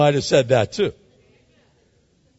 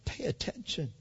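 quiet voice of God; 3 semitones higher, 10 dB quieter than usual. Pretend. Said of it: "God is saying".

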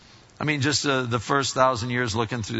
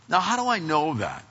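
ordinary talk, nothing marked

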